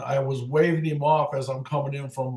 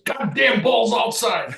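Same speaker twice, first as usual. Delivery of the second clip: grumbling O'Neill; 8 semitones higher, 4 dB louder, slower than usual. Retold or imitated. O'Neill complaining, voiced.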